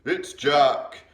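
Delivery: loud, high terrified bellow; the giant howling out his enemy's name; giant's bellow. A narrator's reading. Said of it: "bellowed the flesh lump eater".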